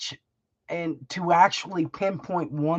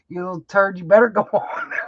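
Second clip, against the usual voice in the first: laughing; 2 semitones higher, 6 dB louder, faster than usual. Real, not acted. Teasing son, laughing.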